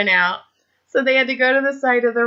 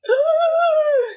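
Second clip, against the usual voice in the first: high, crying ooh in an exaggerated sob; mock wail. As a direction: let out a hammed-up crying whimper. Retelling her own stressed reaction.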